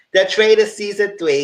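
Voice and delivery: loud, high show title call; excited announcement. Real, not acted. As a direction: announce it like a hype host.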